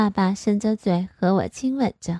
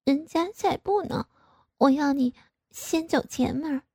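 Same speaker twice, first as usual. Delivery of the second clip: high, soft pouty refusal; pouting; 6 semitones higher, 3 dB quieter than usual. A narrator's reading. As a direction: pout and sulk it out. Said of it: "撅着嘴说". (撅着嘴撒娇)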